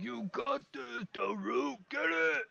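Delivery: big high-pitched creature rooting from the crowd; cheering ogre brother. Acted. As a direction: cheer your brother on at full strain. Ogre's brother cheers him on.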